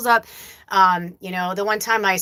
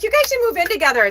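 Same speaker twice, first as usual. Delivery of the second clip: excited quote; 5 dB louder, faster than usual. Retold or imitated. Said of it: "I was like".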